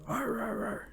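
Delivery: high yappy mock dog bark; imitated dog bark. Imitating his dog barking at night, high mock bark.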